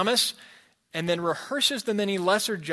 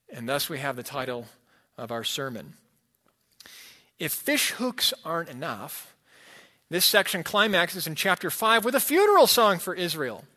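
ordinary talk, nothing marked

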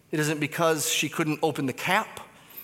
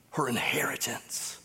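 whisper; 3 semitones lower, 7 dB quieter than usual. Real, not acted. Sermon on her sacrifice.

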